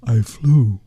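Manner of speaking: ordinary delivery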